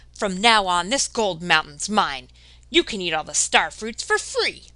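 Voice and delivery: greedy claim. Acted. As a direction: stake your claim like a greedy woman. Greedy woman claims the gold mountain.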